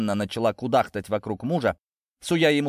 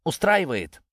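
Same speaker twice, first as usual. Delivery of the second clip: higher-pitched defiant cry; 7 semitones higher than usual. A narrator's reading. Natasha confronting Ginzburg, crying out. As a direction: throw it out as a challenge. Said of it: "выкрикнула она".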